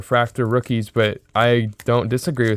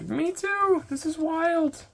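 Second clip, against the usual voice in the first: very high, soft squeaky voice of disbelief; a high, soft amazed squeal; amazed squeak; 8 dB quieter, much slower than usual. Retold or imitated. Retold amazed reply to fan.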